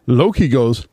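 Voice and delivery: ordinary talk, nothing marked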